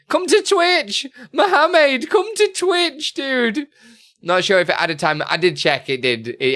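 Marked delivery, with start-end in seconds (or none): 0.1-3.7 high, loud laughing plea; laughing shout. Real, not acted. Streamer calling a viewer to Twitch, laughing shout. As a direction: call out through leftover laughter.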